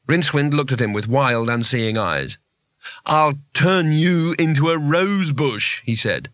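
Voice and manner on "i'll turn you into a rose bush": slow, higher, dazed threat; crazed wizard threat. Acted. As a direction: draw it out, drunk with magic. Dazed, power-drunk wizard threatening someone.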